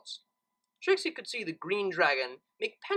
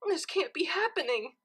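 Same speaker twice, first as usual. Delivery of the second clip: weeping; 12 semitones higher than usual. A narrator's reading. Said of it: "Twilight began to weep".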